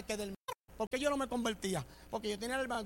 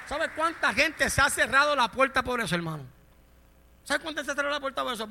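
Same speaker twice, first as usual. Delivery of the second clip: raised, higher preaching voice; forceful preaching; 3 semitones higher, 9 dB louder than usual. Real, not acted. Preacher challenging the congregation about shut doors, forceful.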